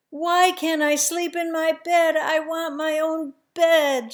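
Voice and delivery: put-on high, loud, pleading wail of a tired little girl; child's tearful protest; crying child. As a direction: cry out like an exhausted, frightened child. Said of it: "she's crying".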